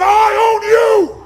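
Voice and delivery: high, loud, drawn-out taunt; full-force challenge, each word stretched; challenging shout. Shown as a demonstration. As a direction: shout it slowly to dominate your opponent. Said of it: "Come on, do it again".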